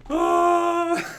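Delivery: completely losing it; helpless laughter. Real, not acted. Vlogger cracking up at the Shane and Dale dub.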